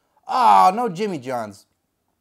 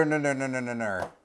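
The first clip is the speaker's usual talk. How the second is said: stuttered syllables trailing down low; Paul Heyman; 8 semitones lower, 6 dB quieter than usual. Acted. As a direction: let the name's last syllable repeat, sinking and softening. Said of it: "Said it just like Paul Heyman".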